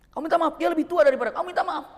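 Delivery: loud, high, fast command to apologize; firm order. Retold or imitated. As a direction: tell him to say sorry, no argument. Sheikh orders driver to apologize.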